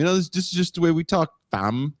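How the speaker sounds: high, mocking Toronto slang voice; quick, high mock Toronto accent; fake Toronto accent. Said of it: "that fake Toronto accent thing".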